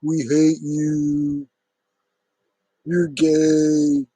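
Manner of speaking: hammed-up taunting of the harassers; mocking the trolls. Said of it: "always the same thing over and over".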